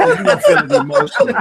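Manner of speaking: cracking up at own punchline; laughing punchline. Real, not acted. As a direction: laugh through the payoff line.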